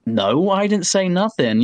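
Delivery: slow, high-pitched retort; imitated defensive denial. Acting out defensive reply in quarrel.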